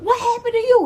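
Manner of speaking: high, loud alarmed question; shocked relatives. Retold or imitated. Relatives seeing mama's eye, high and alarmed. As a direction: ask in alarm.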